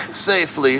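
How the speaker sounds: ordinary talk, nothing marked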